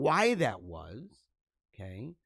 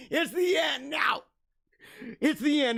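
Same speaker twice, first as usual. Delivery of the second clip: mock wail; 9 semitones higher than usual. Mock lament at show's end.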